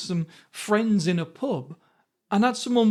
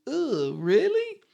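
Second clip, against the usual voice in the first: drawn-out, higher-pitched mock disbelief; sceptical disgust; 6 semitones higher, slower than usual. Son silently judging the Indian accent, imitated sceptical disgust. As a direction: voice a slow, doubtful cringe. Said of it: "as if to say".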